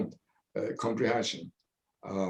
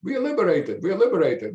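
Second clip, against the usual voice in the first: higher-pitched mock calling out to a friend; retold excited yelling; 7 semitones higher than usual. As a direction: call him out of the outhouse, half-yelling with joy. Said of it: "they were yelling at me".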